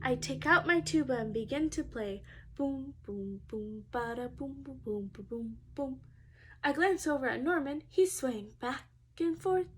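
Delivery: ordinary delivery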